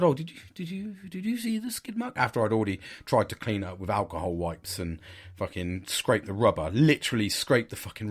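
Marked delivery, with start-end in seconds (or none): 0.0-2.1 high-pitched, hesitant retold question; raised pitch, stumbling into the question; acted tentative question. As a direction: ask haltingly in a much higher voice. Retelling his question to wife.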